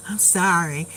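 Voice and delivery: lightly voiced child, low and soft; imitated apology. Child apologizing to Mima after teasing her, imitated low and soft. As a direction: drop low and soft for a sheepish sorry.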